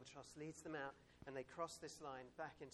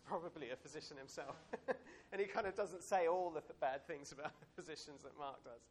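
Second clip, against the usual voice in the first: laughing; 9 dB louder than usual. Real, not acted. Laughing about Luke the physician.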